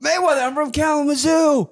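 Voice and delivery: starstruck nerd. Retold or imitated. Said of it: "I turned to a fucking nerd".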